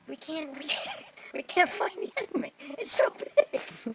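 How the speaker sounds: high, laughing mock helplessness; laughing retold quote. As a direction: crack up while imitating baffled soldiers. Laughs while quoting lost soldiers.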